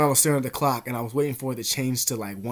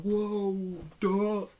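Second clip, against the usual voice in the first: high, startled gasp of a whoa; 6 semitones higher than usual. Retold or imitated. Stunned by the gust, high startled whoa. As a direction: caught off guard by a blast of wind.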